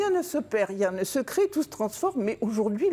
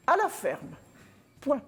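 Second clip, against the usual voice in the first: dictating; 7 semitones higher than usual. Retold or imitated. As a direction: read it out like a school dictation. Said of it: "il commence la dictée".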